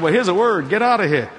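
loud, punchy comic command; mock stern order. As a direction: deliver a blunt prophetic word for laughs. Comic blunt reply, called out.

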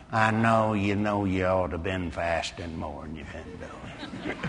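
low, soft accusing tempter; hushed, low devil laying on guilt; insinuating devil. Acted. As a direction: needle them quietly in a deep voice. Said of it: "the devil starts pushing you around".

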